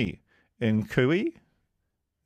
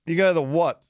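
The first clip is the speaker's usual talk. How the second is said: ordinary delivery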